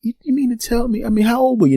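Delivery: you just heard something unbelievable; rising shocked question; incredulous. Retold or imitated. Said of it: "I said".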